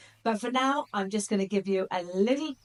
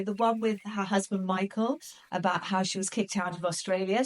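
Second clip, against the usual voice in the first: normal talking voice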